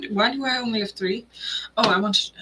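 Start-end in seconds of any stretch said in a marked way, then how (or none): none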